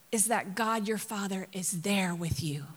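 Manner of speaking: hushed, intimate whisper. Acted. Preacher revealing the foundation of prayer to the congregation, whispering. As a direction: lean in and whisper it as a secret. Preacher stresses the key truth.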